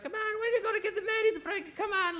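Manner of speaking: very high, nagging mother's voice; Italian mom to her teen son, high and pleading. Retold or imitated. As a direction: whine it like an Italian mama pushing marriage. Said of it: "Italian moms, they'll tell you".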